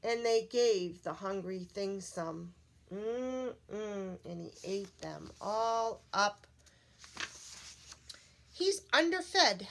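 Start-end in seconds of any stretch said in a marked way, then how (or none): 2.9-4.2 contented yummy hum. Acted. Storyteller hums as the monster enjoys its snack. Hungry thing eating. 8.5-9.6 higher, louder, slow fussy lady. Acted. Lady in red fusses over the hungry thing, higher and slow.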